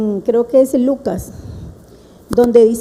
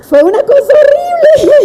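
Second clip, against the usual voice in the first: high and louder, bursting into laughter; laughing; 12 semitones higher, 4 dB louder than usual. Real, not acted. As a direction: laugh through the words, much higher. Recuerdo de la serpiente en el cuarto. (riéndose, más agudo y fuerte)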